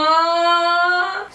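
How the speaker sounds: long, high, held "aww"; drawn-out aww. Real, not acted. Vlogger reacting to the live chat, long aww. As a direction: coo a loud, sustained aww.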